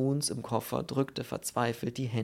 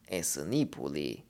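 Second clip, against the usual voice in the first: slow, heavy foreign voice in broken German; slow Polish character voice; 7 semitones lower than usual. Acted. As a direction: drag the words out like a doleful Pole. Pole speaking broken German.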